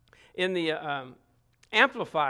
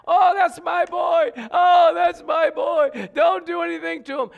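a mother wailing for her son at the back of court; crying mother; 10 semitones higher, 9 dB louder than usual. Retold or imitated. Crying mother at her son's sentencing, high loud wail.

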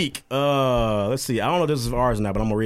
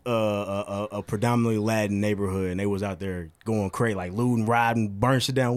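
ordinary delivery

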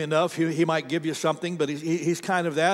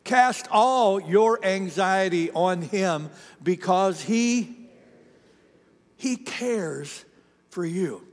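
slow, deliberate group reading; reading scripture together; much slower than usual. Shown as a demonstration. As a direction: read it slowly together with the congregation. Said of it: "Read this out loud with me".

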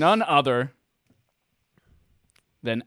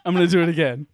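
ordinary talk

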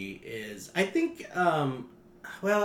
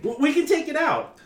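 high, stammering nervous writer; 7 dB louder than usual. Acted. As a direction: stammer it out, frightened. Nervous writer Carl cowers.

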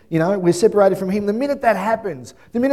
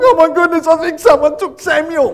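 put-on high, loud scared child; a little boy sure his brother was taken; imitating a high, loud frightened kid; panicked child; 10 semitones higher, 8 dB louder than usual. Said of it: "Nathan's like".

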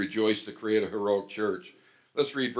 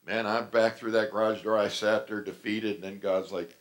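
normal talking voice

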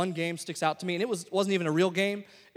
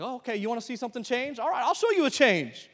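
fired-up defiance; 5 semitones higher than usual. Retold or imitated. Stung player answers his brother's challenge, defiant. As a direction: rise to the challenge, voice climbing. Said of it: "something that kinda rose up inside of me".

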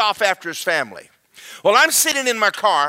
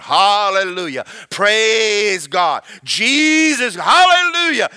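excited worship; 2 semitones higher, 5 dB louder, slower than usual. Acted. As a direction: shout praises with building joy. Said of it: "he just gets excited".

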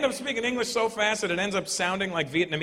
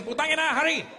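mock Filipino yell; 10 semitones higher than usual. Comedian mocking Filipino karaoke fan, high Filipino accent yell. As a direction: yell it like an impatient auntie at karaoke.

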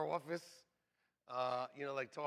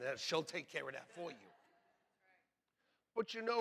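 ordinary delivery

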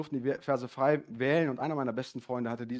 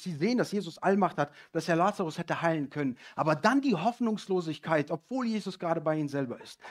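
ordinary talk, nothing marked

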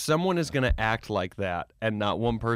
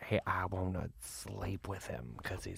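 creepy mother impression; 3 semitones lower, 6 dB quieter than usual. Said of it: "you creep me out when you do a voice so perfect".

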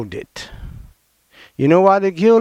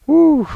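excited whoop; 10 semitones higher, 4 dB louder than usual. Real, not acted. Exclaims after reading scripture.

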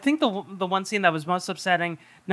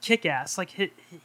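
ordinary talk

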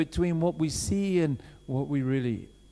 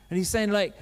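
normal talking voice

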